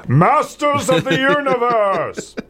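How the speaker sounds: high, drawn-out heroic proclamation; hammy announcer cry. Pretend. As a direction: belt it out like a cartoon title, laughing at the end. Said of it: "I'm sorry, I have to".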